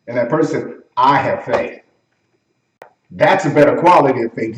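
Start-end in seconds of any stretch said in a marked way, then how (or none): 1.0-1.8 low, grounded declaration; firm conviction. Acted. A person with better faith, firm conviction. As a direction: plant each word with certainty. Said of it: "You hear the way they say it?".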